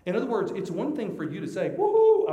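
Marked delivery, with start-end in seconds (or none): 1.7-2.3 high, loud "woohoo"; piercingly pitched-up hurrah; mock cheer. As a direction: whoop in exaggerated celebration.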